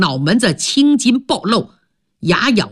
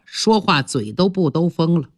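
usual speaking voice

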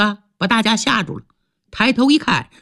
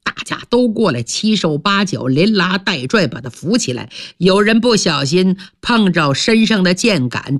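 plain reading voice

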